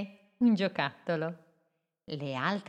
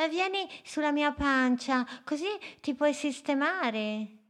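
much higher, kind voice; kind otter voice; 9 semitones higher than usual. Acted. Otter Amalia invites the boat onto her belly, higher voice.

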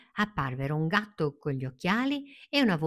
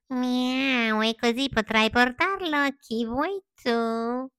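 high meowing cat voice; 8 semitones higher, 3 dB louder, slower than usual. Acted. Cat adding to the fox's pitch, high and feline. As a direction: meow then purr the offer. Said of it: "aggiunse il gatto".

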